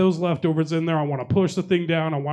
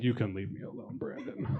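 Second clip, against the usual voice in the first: softer, fading to a whisper; quiet brush-off; 6 semitones lower, 13 dB quieter than usual. Retold or imitated. Waitress quietly waving the comic off, a hushed weary brush-off. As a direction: shut him down under her breath. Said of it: "she's like".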